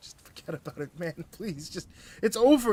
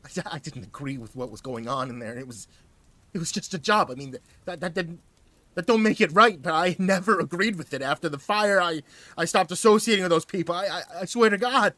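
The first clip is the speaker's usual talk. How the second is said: murmured confession; 8 semitones higher, 11 dB louder than usual. Acted. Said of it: "He murmured".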